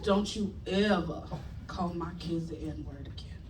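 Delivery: slow, low warning sinking to near-whisper; measured dramatic monologue, deep and very soft; Viola Davis monologue moment. Retold or imitated. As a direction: deliver a grave, theatrical hushed threat. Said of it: "my grandma didn't talk like that".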